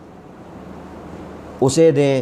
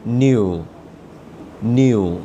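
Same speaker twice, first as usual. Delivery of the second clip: ordinary talk, nothing marked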